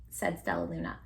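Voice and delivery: ordinary delivery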